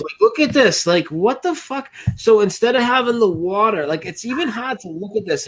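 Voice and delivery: high, incredulous outburst at the photo; can't believe what he's seeing, pitch way up; disbelief. Real, not acted. Reacting to the water bridge photo.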